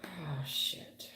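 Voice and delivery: breathy whispered curse under the breath; startled whisper at the cards; whispered oh shit. Real, not acted. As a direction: mutter it to yourself in a whisper. Whispered reaction to a card.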